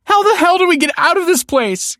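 very high, loud panicked shout; distraught woman yelling; frantic cry. A narrator's reading. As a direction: cry out in desperation. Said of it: "cried the woman".

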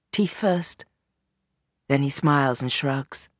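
usual speaking voice

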